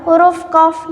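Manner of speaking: ordinary talk, nothing marked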